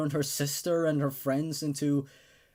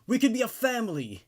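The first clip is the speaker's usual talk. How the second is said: high, loud desperate yell; shouted; 11 semitones higher, 5 dB louder than usual. Acted. Captor Richie blocking the basement door, shouting desperately. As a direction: scream it as the captor blocks the escape. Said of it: "he shouted".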